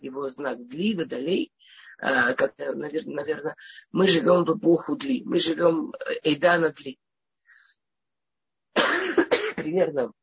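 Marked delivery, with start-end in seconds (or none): none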